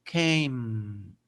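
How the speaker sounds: Came said as keim, lips closing on the m; Came, long ey then a closed m; ending with closed mouth. Shown as a demonstration. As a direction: end Came with the mouth shut. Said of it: "debe terminar la pronunciación de came con la boca cerrada".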